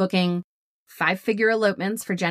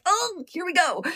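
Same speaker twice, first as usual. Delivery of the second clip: high, mock-panicked aside; hammed-up "uh-oh" voice; retold dread; 9 semitones higher than usual. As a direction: brace for trouble, exaggerated and high. Retold dread at being confronted.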